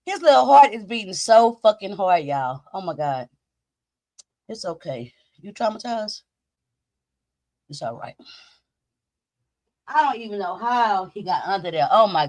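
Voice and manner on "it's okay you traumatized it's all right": very soft, low, tender murmur; soothing. Real, not acted. Owner to traumatized puppy with pounding heart, soothing. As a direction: comfort a frightened pet, hushed and low.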